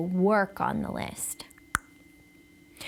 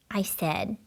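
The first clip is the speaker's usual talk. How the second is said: plain reading voice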